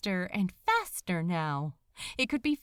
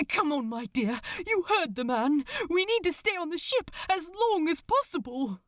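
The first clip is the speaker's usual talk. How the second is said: urgent plea; 7 semitones higher, faster than usual. Acted. Aziraphale urges Crowley on sinking ship.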